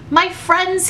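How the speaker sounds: a lightly voiced salesman, crisp and bright; clear, keen voice. A narrator's reading. Stranger greeting the Sneetches, clear and keen. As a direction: pitch the stranger clear and keen, a touch high. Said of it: "in a voice clear and keen".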